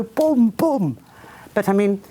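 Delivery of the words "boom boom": gun reply. Retold or imitated. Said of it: "wanted to just go".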